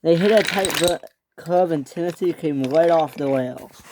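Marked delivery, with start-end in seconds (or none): none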